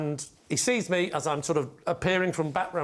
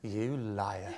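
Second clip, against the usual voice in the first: low, soft imagined dog's voice; quiet accusation; 8 semitones lower, 8 dB quieter than usual. Retold or imitated. Panelist voices a watching dog's thought, quiet accusation.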